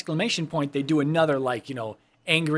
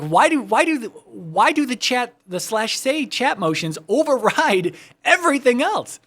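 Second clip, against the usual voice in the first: retold exasperation; 7 semitones higher, 4 dB louder than usual. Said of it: "I was like".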